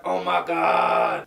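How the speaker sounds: loud, drawn-out mock amazement; overjoyed cry. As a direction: bellow it slowly in hammed-up astonishment. Beast sees Belle arrive.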